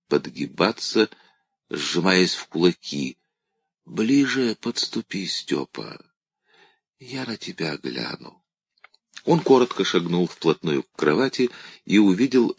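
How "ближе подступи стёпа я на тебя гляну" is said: faint, fading to almost nothing; barely enough breath to speak; weak dying voice. A narrator's reading. Dying old woman calls Stepan.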